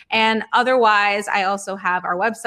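ordinary talk, nothing marked

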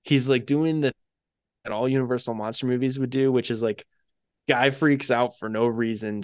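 ordinary talk, nothing marked

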